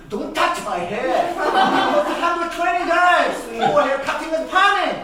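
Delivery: high, loud bratty snap; bratty boy telling off a grown-up; cheeky kid. Retold or imitated. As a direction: scold the adult like a spoiled kid. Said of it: "cheeky kids".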